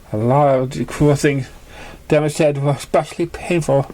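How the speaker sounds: usual speaking voice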